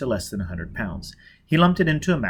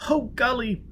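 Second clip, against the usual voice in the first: put-on high, urgent young woman's voice; Jiz's high voice; 12 semitones higher than usual. Jiz calling Foyle over the earphones, high and agitated. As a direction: pitch way up and press with worry.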